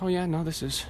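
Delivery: high, mock-casual brush-off; smooth bluff. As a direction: pretend you're supposed to be there. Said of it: "like you're supposed to be there sort of manner".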